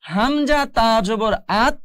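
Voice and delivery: slow spelled reading of "হামজা তা জবর আত"; name each letter slowly, ending on a joined "আত"; spelling-out. Shown as a demonstration. Teacher shows the same rule again, slow spelling up to "আত". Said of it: "একটু ভালো করে লক্ষ্য করুন".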